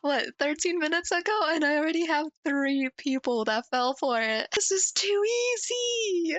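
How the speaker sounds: high-pitched, giddy triumph; gleeful gloating. Real, not acted. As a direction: crow over an easy win, drawing words out. Past clip, gloating over first comments.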